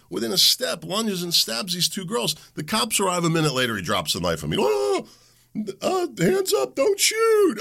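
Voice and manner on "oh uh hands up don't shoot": high whiny mock surrender voice; mocking whine of a cornered man. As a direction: squeak it out, pleading much higher.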